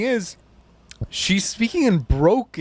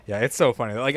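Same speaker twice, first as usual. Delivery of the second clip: usual speaking voice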